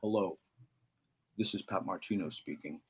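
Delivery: a lightly imitated low, soft Italian old jazzman's greeting; hint of a deep-voiced Italian elder on the phone. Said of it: "I hit this deep voice".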